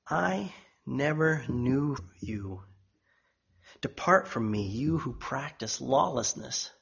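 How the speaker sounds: soft, slow, grave pronouncement; low-voiced, weighty dismissal; solemn rejection. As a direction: pronounce judgment quietly, drawing it out. Jesus solemnly sends the false away.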